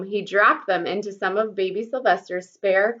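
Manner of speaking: usual speaking voice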